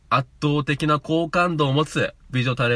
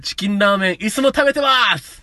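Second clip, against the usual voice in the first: ad line imitation; 7 semitones higher, 5 dB louder than usual. Mimics the TV ad line.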